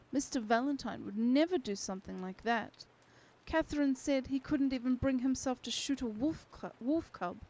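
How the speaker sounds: usual speaking voice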